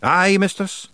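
high Scots Feegle voice; proud little rogue answering up. A narrator's reading. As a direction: own up proudly. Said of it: "said Rob proudly".

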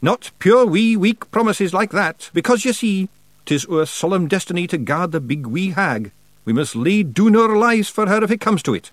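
high, loud Scots declaration; little warrior making a proud vow; proud wee Feegle. A narrator's reading. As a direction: declare your solemn duty in high Scots. Rob's proud declaration in character.

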